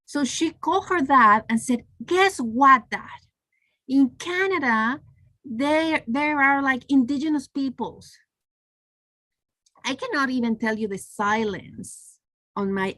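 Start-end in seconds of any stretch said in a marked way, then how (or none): 2.0-8.2 retold excited daughter, pitched higher; excited child. Little daughter telling her dad her discovery, excited. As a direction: imitate a kid announcing big news. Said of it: "So she was so excited".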